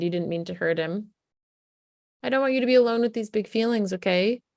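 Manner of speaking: put-on tender reassurance to an upset child; gentle soothing parent. Shown as a demonstration. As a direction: coax the kid gently through the door.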